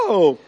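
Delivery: short, loud "oh" cry; retold scream. Preacher acting out the healed woman's cry, retold scream. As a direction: cry out as she did. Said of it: "and she screamed".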